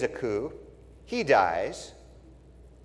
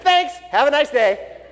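higher, slow mock-cheery thanks; mock polite; 11 semitones higher, 6 dB louder, slower than usual. Joab thanks the town for the head, mock-cheery and slow.

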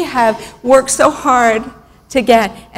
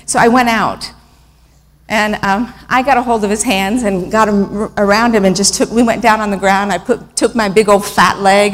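usual speaking voice

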